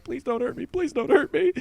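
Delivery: high, pleading mock fear; begging him not to hurt me; scared pleading. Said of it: "I'm, like, scared".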